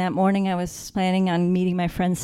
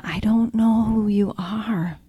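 whispered slowly, lost and unsure; hushed, bewildered whisper; 3 semitones higher, slower than usual. Retold or imitated. Dreamer whispering to the unseen voice, bewildered. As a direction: breathe it out to a voice you can't see.

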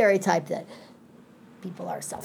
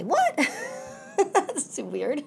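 mock baffled what; 9 semitones higher, slower than usual. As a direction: squeak it out like someone caught off guard. Mock teacher's baffled reaction.

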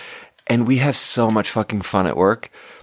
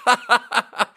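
short high giggle; amused laughter; 11 semitones higher than usual. Real, not acted. Admitting a coworker fooled you, high giggle. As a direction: crack up at yourself.

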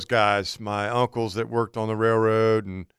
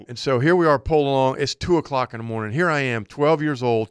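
ordinary talk, nothing marked

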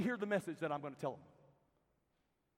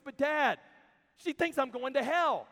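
put-on louder, higher child pleading with dad; the little sister protesting, raised and hurt; upset child's wail; 6 semitones higher, 10 dB louder than usual. Younger sister protesting to dad, loud and higher.

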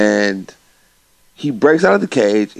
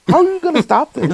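pitched way up in disbelief; mock disbelief at the villain's plan; incredulous question; 3 dB louder than usual. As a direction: ask it high and loud, baffled.

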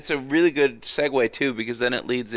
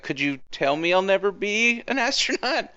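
higher-pitched mock child asking; imitated girl; 9 semitones higher than usual. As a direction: lightly put on a girl's challenge. Acts out young girl challenging teacher.

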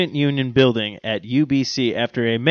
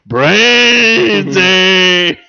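zombie; 5 semitones higher, 9 dB louder than usual. Pretend. Mock zombie growl.

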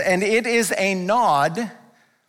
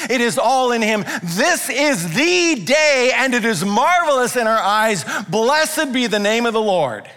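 full-force preaching; 4 semitones higher than usual. Acted. Preacher to congregation, rising full-force declaration. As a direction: build to a proclaiming peak.

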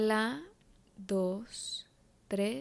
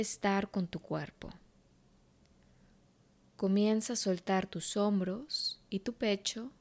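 guided meditation; 5 dB quieter than usual. Acted. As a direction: guide her gently into calm.

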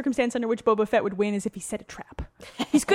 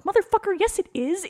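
indignant; 10 semitones higher, 3 dB louder than usual. Real, not acted. Indignant retort.

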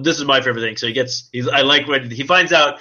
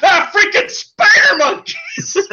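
much higher, louder, slow yell broken by laughter; laughing mock yell; 6 dB louder, much slower than usual. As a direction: yelp it as if jumped on. Imitating Deadpool attacked from behind.

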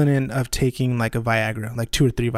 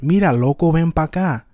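louder Puerto Rican street call; Puerto Rican accent; 4 semitones higher, 6 dB louder than usual. Shown as a demonstration. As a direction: call a buddy over, Puerto Rican style. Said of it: "means crazy, but can be used".

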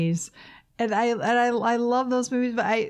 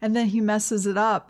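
ordinary delivery

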